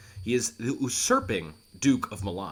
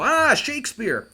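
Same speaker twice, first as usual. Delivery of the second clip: mock fright; 12 semitones higher, 7 dB louder, slower than usual. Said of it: "'cause you go".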